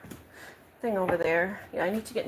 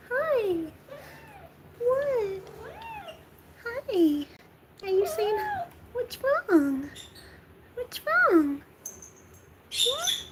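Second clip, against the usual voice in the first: much higher cooing pet voice; slower than usual. Real, not acted. Asking her crying cat what's wrong in high baby talk.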